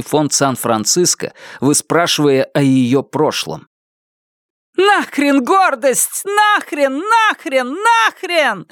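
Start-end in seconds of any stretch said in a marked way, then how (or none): 4.8-8.7 very high, frantic repeated cries; shouting. A narrator's reading. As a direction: keep crying it out at a high pitch. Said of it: "прокричала она". (исступлённый высокий крик)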